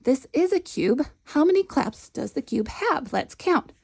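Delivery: high encouraging teacher voice; bright praise from the teacher; teacher to a child. Shown as a demonstration. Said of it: "And you can say".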